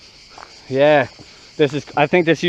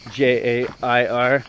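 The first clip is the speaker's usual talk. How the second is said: slow letter-by-letter spelling; slower than usual. Shown as a demonstration. Spelling the YouTuber's name for viewers, slow and deliberate. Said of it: "If you don't know how to spell".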